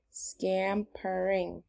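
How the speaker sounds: scampering read out very slowly; slow check read. Shown as a demonstration. Teacher checking the spelling aloud, slow. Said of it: "Does it look right".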